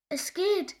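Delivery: high, loud triumphant shriek; shrill shout of success; shrill cry. A narrator's reading. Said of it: "schrie es schrill".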